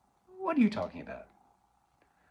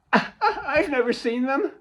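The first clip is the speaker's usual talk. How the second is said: laughing; 12 semitones higher, 6 dB louder than usual. Acted. Laughing retort.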